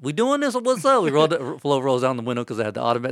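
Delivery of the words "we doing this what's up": high, loud race dare; retold eager challenge. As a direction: throw down a challenge between drivers. Acts out street race challenge.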